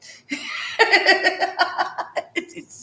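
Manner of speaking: high, wheezy run of laughter; helpless giggle. Real, not acted. Tickled by her twin's tantrum, helpless giggling. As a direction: dissolve into real high giggles. Cracks up at the memory.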